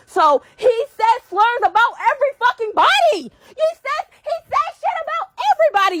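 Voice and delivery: erupting in exasperation; heated outburst. Real, not acted. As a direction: voice jumps up high and loud. Heated rant about Keith.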